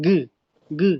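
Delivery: গু said short with teeth held together. Shown as a demonstration. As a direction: clench teeth and say গু. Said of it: "দাঁতগুলো একত্রে রেখে". (দাঁত চেপে গু বলা)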